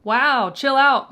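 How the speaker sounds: loud, slow mock shock; drawn-out loud wow, chill out. Real, not acted. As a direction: react loudly as if offended. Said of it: "Maybe fuck yourself".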